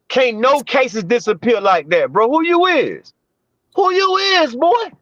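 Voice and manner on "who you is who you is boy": incredulous who are you. Acted. Mocking someone's identity.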